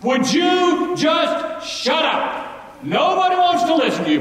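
high, loud, mocking "shut up"; crowd shouting him down. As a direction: snap at the beggar like an annoyed crowd. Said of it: "how are the people responding".

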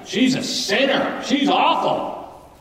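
high, slow, scornful judgment; disdainful leaders. Retold or imitated. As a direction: sneer it like a self-righteous leader. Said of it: "they begin to think".

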